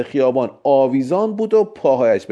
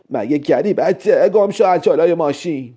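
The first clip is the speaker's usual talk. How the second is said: put-on irate driver ordering a child away; angry old woman; 6 semitones higher than usual. A narrator's reading. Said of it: "پیرزن یکی دو دفعه بوق زد و دوباره گفت". (با پرخاش پیرزنانه)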